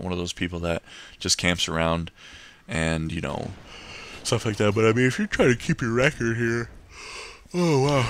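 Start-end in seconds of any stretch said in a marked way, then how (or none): 4.2-6.7 words stretched by a yawn. Real, not acted. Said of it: "sorry, I'm yawning again". 7.5-8.1 yawning. Real, not acted. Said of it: "sorry, I'm yawning again".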